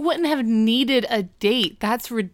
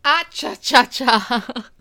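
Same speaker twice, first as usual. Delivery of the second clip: loud, high, goofy ah cha cha cha; Eric's ah cha cha; 4 semitones higher, 5 dB louder, much slower than usual. Retold or imitated. Imitating Eric's goofy phone celebration, loud and high. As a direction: belt out a silly cha-cha whoop. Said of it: "it struck me as very funny".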